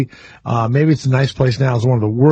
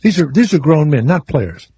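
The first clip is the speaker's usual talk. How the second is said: ordinary talk, nothing marked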